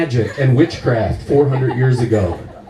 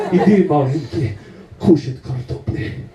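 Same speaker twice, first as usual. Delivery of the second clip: put-on foreign girlfriend comforting. Polish girlfriend comforting in her language.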